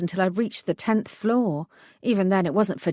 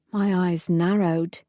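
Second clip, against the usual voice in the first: ordinary delivery, nothing marked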